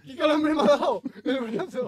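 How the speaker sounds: high, laughing cry about the jolt; laughing in shock. Real, not acted. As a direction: laugh through the pain of the shock. Just got shocked.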